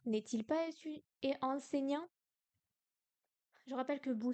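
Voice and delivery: ordinary delivery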